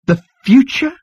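high, loud incredulous question; high-pitched astonished outburst; exclaimed. A narrator's reading. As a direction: exclaim it in disbelief, rising sharply. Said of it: "exclaimed Zaphod".

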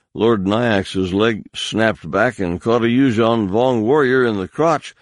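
ordinary delivery

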